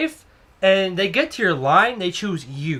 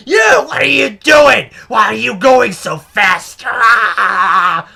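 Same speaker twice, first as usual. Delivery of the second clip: mock yelling; 3 semitones higher, 7 dB louder, much slower than usual. Crazy customer screaming at her cashier, hammed-up yelling. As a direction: screech it slowly in outrage, imitating a ranting customer. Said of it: "she starts yelling at me".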